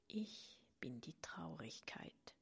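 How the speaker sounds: halting whisper. A narrator's reading. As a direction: whisper it haltingly, barely audible. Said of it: "flüsterte die Stimme stockend und so leise".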